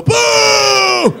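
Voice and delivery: high, loud drawn-out boo; booing crowd. Retold or imitated. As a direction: jeer at him all the way to the car. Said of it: "they spit on the window".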